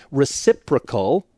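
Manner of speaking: reciprocal drawn out slowly with emphasis; stress on reciprocal. Real, not acted. Counselor underlines "reciprocal" for listeners, slow and emphatic. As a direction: lean on "reciprocal", stretching it out. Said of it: "I wanna emphasize that word".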